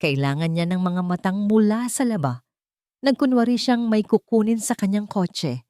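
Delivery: ordinary delivery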